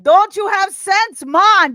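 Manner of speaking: caricatured Jamaican patois, drawn out and loud; mock Jamaican accent. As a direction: play a Rasta scolding in a Jamaican accent. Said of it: "I put the Rasta hat on her head".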